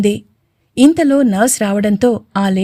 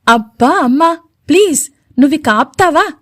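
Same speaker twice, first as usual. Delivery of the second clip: irritated; 5 semitones higher than usual. A narrator's reading. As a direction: snap it out, fed up with mom. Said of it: "చిరాగ్గా అన్నాడు".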